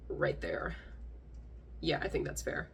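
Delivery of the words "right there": hushed aside, almost whispered; whispered placement. Real, not acted. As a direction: drop to a near whisper. Placing meme on iceberg.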